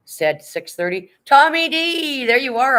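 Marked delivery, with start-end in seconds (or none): 1.3-2.8 loud, high whoop of a greeting; big delighted call, pitch way up; excited shout-out. Real, not acted. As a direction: holler the name as they walk in. Calls out viewer just arrived.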